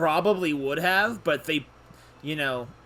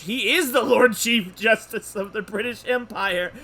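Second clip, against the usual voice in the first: loud, high, slow emphasis on the title; amazed exclamation; 5 semitones higher, 6 dB louder than usual. Real, not acted. Player reacting to the judge's title, loudly amazed. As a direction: proclaim it in loud disbelief.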